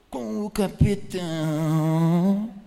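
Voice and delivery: long, loud, low drawn-out name; mock-ominous drawl. Preacher naming the devil to the congregation, mock-ominous and hugely drawn out. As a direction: stretch the devil's nickname out, swelling at the end.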